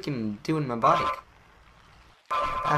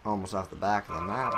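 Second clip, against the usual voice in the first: ordinary talk, nothing marked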